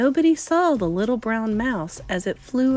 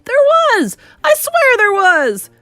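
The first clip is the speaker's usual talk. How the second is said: high, loud indignant protest; shouting back; 13 semitones higher, 7 dB louder than usual. A narrator's reading. Man to disbelieving cooks, shouting back. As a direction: insist at the top of your voice. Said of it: "the man was shouting back".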